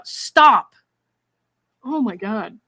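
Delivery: begging it to end, disgusted; fed-up plea; exasperated stop. Real, not acted. Reacting to creepy message.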